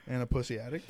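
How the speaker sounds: usual speaking voice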